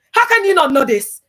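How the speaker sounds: loud, high mocking jeer; mocking shout. Pastors jeering at the girl who failed scripture quiz, loud mocking. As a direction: jeer at her like the pastors did.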